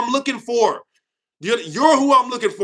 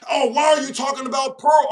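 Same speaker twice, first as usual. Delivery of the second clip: mock chatter; 4 semitones higher than usual. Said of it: "people in the chat like".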